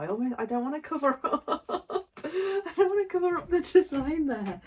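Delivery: giggling through the words, pitch jumping up; laughing. Real, not acted. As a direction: laugh while you talk, delighted. Laughing, not wanting to cover the design.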